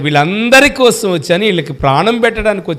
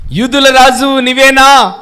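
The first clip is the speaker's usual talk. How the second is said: slow, louder, higher question as Pilate; 6 semitones higher, 5 dB louder, slower than usual. Acted. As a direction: ask it slowly like a judge.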